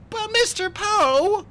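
put-on very high, drawn-out boy's protest; loud, slow indignant whine. A narrator's reading. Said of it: "Klaus said indignantly".

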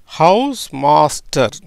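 House master spoken slowly, word by word; slow demo. Shown as a demonstration. Teacher showing students how it sounds, slow and deliberate. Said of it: "எப்படி வருது பாருங்க".

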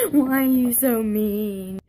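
whiny mock sob; hammed-up crybaby whine; fake crying. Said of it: "they cry about everything".

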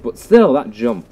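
normal talking voice

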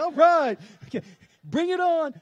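high, loud mock bravado; shouted bring-it-on bravado; mock eager. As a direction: cheer on the apocalypse like a fan. Mocking eager congregants.

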